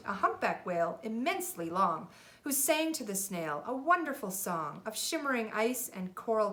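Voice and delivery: put-on lively read-aloud for a child; storybook reading. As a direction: read it like a bedtime picture book. Parent reads picture book aloud.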